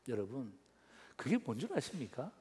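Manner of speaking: normal talking voice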